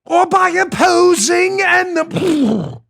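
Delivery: high, slow stage declaiming; hammy Hamlet. Acted. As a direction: draw out the verse like a ham actor. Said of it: "So they're doing Hamlet".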